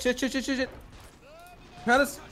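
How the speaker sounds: ordinary delivery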